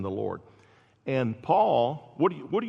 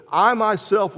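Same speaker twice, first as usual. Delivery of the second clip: "I myself" leaned on a little louder; stress on I MYSELF; 7 semitones higher, 7 dB louder than usual. Retold or imitated. Preacher quoting Paul's words to Corinth, stress on "I myself". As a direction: put firm weight on "I myself". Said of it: "it's an emphatic way".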